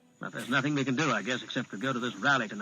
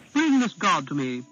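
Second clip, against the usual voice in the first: put-on commanding young prince, a bit higher and louder; imperious command; 4 semitones higher, 5 dB louder than usual. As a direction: order it like a haughty little king. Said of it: "with an imperious air".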